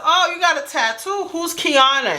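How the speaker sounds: high, surprised "who's Kiana"; mock surprised question. Girlfriend spots the tattoo while he drives, high and surprised. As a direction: act shocked to find the tattoo. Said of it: "And I said".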